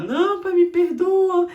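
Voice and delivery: put-on high, loud, sobbing plea of a scared child; crying child. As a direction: beg through tears like a little kid about to be spanked. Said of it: "ele começa a chorar".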